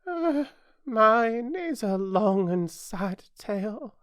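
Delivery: high, slow, mournful mouse voice; sighing. A narrator's reading. Mouse turning to Alice about its tale, sighing. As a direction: sigh it out slowly in a high voice. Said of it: "turning to Alice and sighing".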